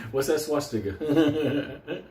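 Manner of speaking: normal talking voice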